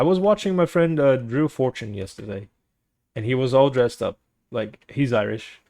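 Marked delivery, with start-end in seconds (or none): none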